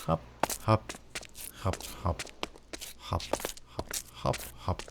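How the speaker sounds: quiet, voiceless little hops; hushed hopping sounds. Real, not acted. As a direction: whisper each hop along with the jump. Hopping along in the game.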